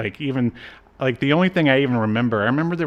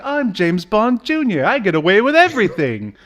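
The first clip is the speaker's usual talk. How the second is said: higher, fast, cocky mock voice; mock smug hero; 10 semitones higher, 5 dB louder than usual. Mocking the hero's boast, high cocky voice. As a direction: brag it out like a spoiled spy. Mocking James Bond Junior.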